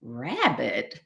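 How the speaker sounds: low, soft puzzled echo; thinking to himself. Acted. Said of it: "thought the fox".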